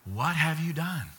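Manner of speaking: soft, grave reproach; hushed, sorrowful-style accusation; God questioning Cain. Acted. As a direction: ask it quietly and let it sink in. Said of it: "What does God say to him?".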